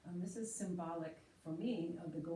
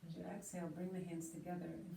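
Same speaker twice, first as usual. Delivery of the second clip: guided meditation; 8 dB quieter than usual. Acted. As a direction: settle them quietly into stillness. Leads seated meditation.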